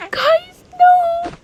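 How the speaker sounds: very high, loud squeal; alarmed outcry. Pretend. Player protesting a mishap at bath time, high alarmed shriek. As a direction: shriek it in pretend dismay. Pretend mishap while washing.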